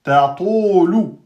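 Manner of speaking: "Ta to lou" slowly, light lam; correct syllables. Shown as a demonstration. Teacher models the word for the class, slowly. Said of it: "Et le lam est aminci".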